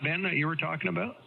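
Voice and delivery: ordinary delivery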